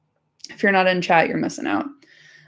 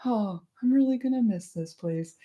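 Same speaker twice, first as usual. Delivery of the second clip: soft, slightly higher mock sigh; Frodo; 4 semitones higher, 5 dB quieter than usual. Fan imagining the hobbit leaving home, lightly mock wistful. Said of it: "like, Frodo being like".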